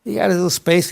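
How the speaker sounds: ordinary delivery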